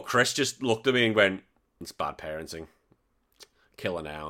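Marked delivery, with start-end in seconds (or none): 1.8-4.3 low, quiet imitation of a friend's verdict; dry confidential mock judgement; retold deadpan aside. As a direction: drop it down, softly and drily.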